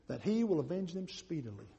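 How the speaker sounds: low, soft, solemn promise; hushed verse reading. A narrator's reading. Preacher reading Jesus' promise to the congregation, low and soft. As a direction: read it quietly, falling at the end. Verse read, then questioned.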